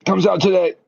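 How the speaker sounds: high-pitched excited announcement; really excited, voice lifted high. Real, not acted. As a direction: blurt the news with pitch jumping up. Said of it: "Are you excited about that?".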